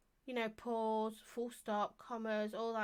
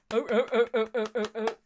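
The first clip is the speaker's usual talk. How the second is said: loud rhythmic run of short "oh" noises; imitated noise; 8 dB louder than usual. Shown as a demonstration. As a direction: punch out a string of identical short oh's. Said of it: "It means like this".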